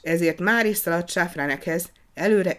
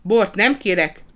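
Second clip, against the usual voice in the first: louder, higher, slow and decided; firm refusal; 4 semitones higher, 8 dB louder than usual. A narrator's reading. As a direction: refuse the wine firmly. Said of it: "határozottan válaszolt".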